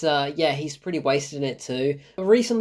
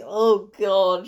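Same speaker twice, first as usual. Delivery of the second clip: ordinary talk, nothing marked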